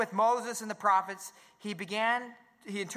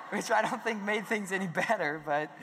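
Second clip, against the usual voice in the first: laughing. Real, not acted. Laughing at the story.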